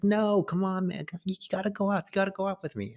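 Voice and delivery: lightly voiced eager boy, urging; imitating an eager kid. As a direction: egg him on like a pushy ten-year-old. Kid friend urging him up.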